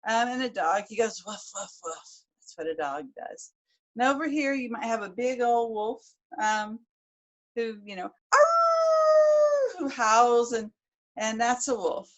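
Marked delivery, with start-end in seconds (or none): 1.3-2.2 dog barking woof. Shown as a demonstration. Imitating a dog's bark. 8.3-9.8 high loud imitated wolf howl. Shown as a demonstration. Storyteller showing British listeners a wolf's howl.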